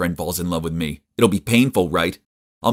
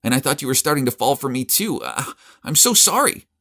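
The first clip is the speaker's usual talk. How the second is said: much higher, pleading and urgent; desperate plea; 6 semitones higher than usual. A narrator's reading. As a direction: beg him to listen. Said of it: "desperate plea to Charles".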